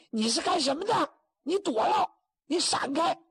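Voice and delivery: put-on very high, shrill-edged angry girl; angry young woman. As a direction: push him away with a high scolding voice. 姑娘与马云龙吵架. (高声怒斥的姑娘)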